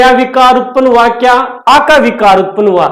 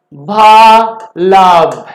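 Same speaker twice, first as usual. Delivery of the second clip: भा लाभ said very slowly, pulled apart syllable by syllable; syllable split demo; 5 semitones lower, much slower than usual. Shown as a demonstration. Teacher showing students where the word breaks, very slow syllable split. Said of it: "इसको यहां से अलग कर रहे हो तो ला".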